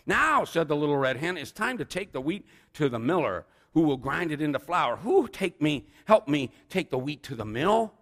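big picture-book reading; story narration. A narrator's reading. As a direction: project it to a room of children. Reads a children's story aloud.

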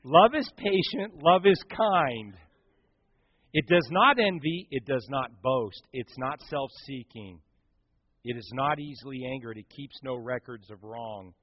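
slow, softening recitation of scripture. Acted. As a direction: recite the verses slowly, fading down.

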